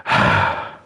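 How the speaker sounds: a deep, short startled "ah"; low monster yelp of surprise; low startled gasp. Retold or imitated. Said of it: "the ink demon's like".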